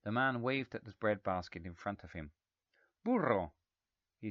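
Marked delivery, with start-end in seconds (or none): 3.0-3.5 Italian word. A narrator's reading. Italian guest asks for butter.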